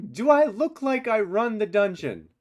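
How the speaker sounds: high, loud indignant retort; exasperated prisoner. Acted. Prisoner answers a plea for food, loudly exasperated. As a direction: throw the question back, loud and high.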